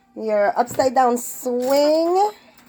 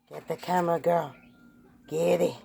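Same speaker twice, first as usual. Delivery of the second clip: normal talking voice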